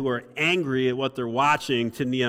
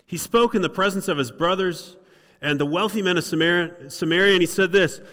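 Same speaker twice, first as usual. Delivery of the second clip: mocking governor; 4 semitones higher, 5 dB louder than usual. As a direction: read it like a furious official mocking the builders. Reading Sanballat's mocking speech.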